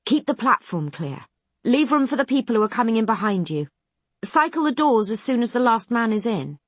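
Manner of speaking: fussy doctor yelling orders at the crew; yelping orders. A narrator's reading. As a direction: snap out orders nobody is listening to. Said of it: "yelping out orders".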